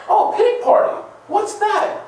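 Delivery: very high, curious mock voice; Jesus puzzled. Jesus asking about the pity party, high mock puzzlement. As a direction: play innocent surprise at a strange idea. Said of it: "if you went up to Jesus and say".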